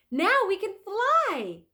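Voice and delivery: put-on thrilled girl shouting; excited. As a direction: announce it with wonder. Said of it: "said Annie".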